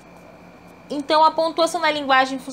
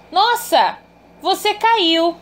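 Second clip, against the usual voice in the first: higher-pitched, mildly surprised cry of Nossa; exclamatory surprise; 7 semitones higher, slower than usual. Shown as a demonstration. Teacher demonstrating an exclamation to students, mild surprise. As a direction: say it like you just saw someone fall. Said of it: "entonação exclamativa".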